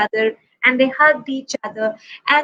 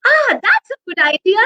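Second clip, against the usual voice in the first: delighted; 9 semitones higher, 4 dB louder than usual. Acted. Polar bear delighted.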